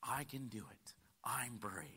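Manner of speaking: soft whispered encouragement. Shown as a demonstration. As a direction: breathe the words like a secret pep talk. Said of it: "Let me tell you what you need to say".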